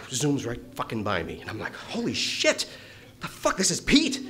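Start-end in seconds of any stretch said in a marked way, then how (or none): 1.7-4.2 amazed. Retold or imitated. Pete zooms past him, high shocked exclamation. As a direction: blurt it out, stunned.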